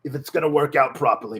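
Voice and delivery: normal talking voice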